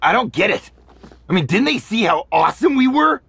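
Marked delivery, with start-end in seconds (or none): 1.3-3.2 excited. Acted. Excited fan recounting fight.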